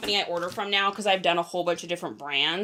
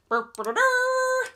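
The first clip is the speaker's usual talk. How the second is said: silly burring noise. Pretend. Silly noise while waiting.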